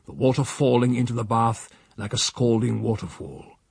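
usual speaking voice

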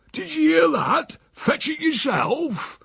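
high, drawn-out outrage; indignant outcry. A narrator's reading. Uncle repeats the refusal to his nephew, indignant. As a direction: repeat the insult in high disbelief.

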